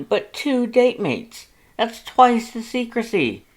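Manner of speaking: shrill-free indignant big-brother voice, loud and high; loud, indignant Papyrus. A narrator's reading. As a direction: scold your brother with mock outrage.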